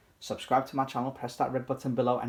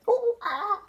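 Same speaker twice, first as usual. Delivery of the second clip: much higher monkey hoot; monkey call. Acted. Naughty Monkey gets the whole banana, high monkey hoot.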